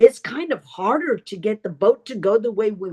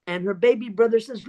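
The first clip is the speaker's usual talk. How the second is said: ordinary delivery, nothing marked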